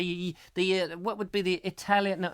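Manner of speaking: ordinary delivery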